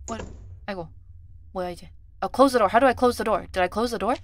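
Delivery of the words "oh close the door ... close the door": loud, urgent fluster; flustered panic. Real, not acted. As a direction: call it out in a sudden panic. Flustered, cannot close the door.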